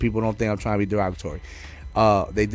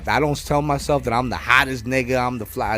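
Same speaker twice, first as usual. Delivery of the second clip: ordinary talk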